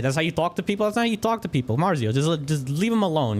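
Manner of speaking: high, scolding voice; scolding npc. Acted. Npc scolding the player, then pleading for Marzio. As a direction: tell him off, then plead to leave him.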